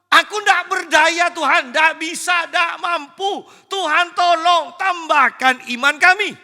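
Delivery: high, loud pleading lament; helpless outcry. Retold or imitated. Preacher voicing the disciples admitting they cannot forgive, loud desperate plea. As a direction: beg the Lord, overwhelmed and powerless.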